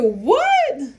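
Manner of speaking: loud, high indignant what; shocked what. Retold or imitated. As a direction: act offended by his tease. Indignant retort to chef.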